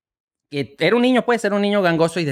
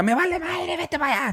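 mock dismissive sister; 10 semitones higher, 4 dB quieter than usual. Sister brushing off in joke.

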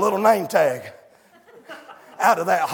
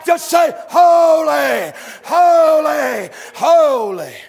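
loud sung whoop of a preacher at full cry; full-force preaching; 8 semitones higher, 8 dB louder than usual. Real, not acted. Preacher whooping the congregation about the throne, loud and sung. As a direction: preach it out, half singing, building to the top.

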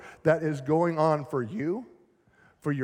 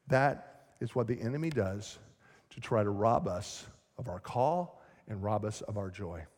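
ordinary talk, nothing marked

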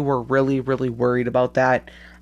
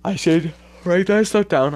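yawning; 2 semitones higher than usual. Real, not acted. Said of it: "I'm sorry that I'm yawning".

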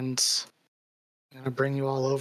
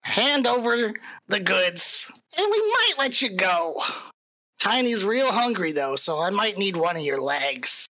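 menacing goblin leader, pitched up; taunting goblin bandit; 5 semitones higher than usual. Acted. Game master voices goblin ambusher.

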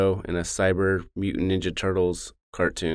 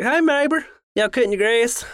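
much higher friendly Southern twang; Southern nice guy; 11 semitones higher than usual. Retold or imitated. A friendly Southern neighbor chatting about the grass. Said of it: "They're like".

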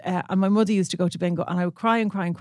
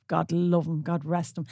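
ordinary talk

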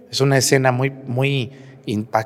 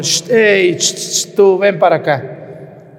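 God calling out; 7 semitones higher, 6 dB louder, slower than usual. Retold or imitated. God calls Moses in sermon.

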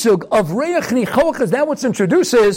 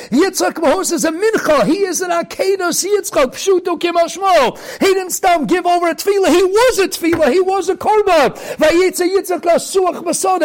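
raised, high, ringing sermon voice; fiery preaching; 5 semitones higher than usual. Acted. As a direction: preach it out at full force. Preaching at full force.

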